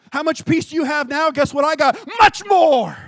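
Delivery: loud and high, building to a huge shout; triumphant divine answer. Acted. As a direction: proclaim it as God's generous boast.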